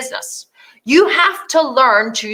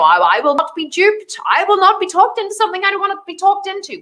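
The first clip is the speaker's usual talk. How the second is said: defiant customer; 8 semitones higher than usual. Retold or imitated. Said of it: "and you're, you know".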